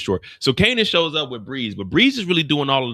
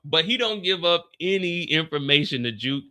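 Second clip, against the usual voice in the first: ordinary talk, nothing marked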